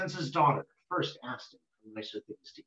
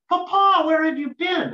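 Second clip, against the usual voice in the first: put-on high, loud anxious cry of a girl; a daughter who feared the worst, calling out; worried daughter; 12 semitones higher, 8 dB louder than usual. Daughter greets her missing father, high and loud with worry. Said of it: "she was worried".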